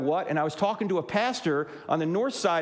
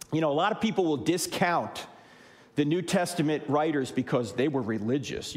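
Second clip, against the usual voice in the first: ordinary delivery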